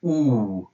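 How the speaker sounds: "oo" as a long rounded u sound; Russian letter oo. Shown as a demonstration. Teacher showing the sound of the Russian letter U. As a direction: round the lips and hold "oo". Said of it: "this is the letter".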